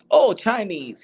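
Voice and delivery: mock know-it-all. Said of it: "the guy goes".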